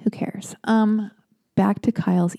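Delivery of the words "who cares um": ordinary talk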